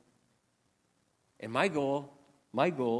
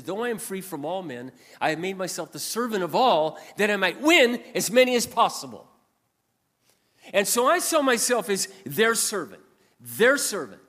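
growing louder and higher into preaching; preaching build; 5 semitones higher, 8 dB louder than usual. Acted. Preaching a verse, rising.

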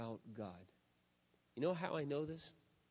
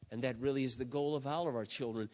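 ordinary delivery

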